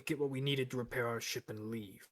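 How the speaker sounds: normal talking voice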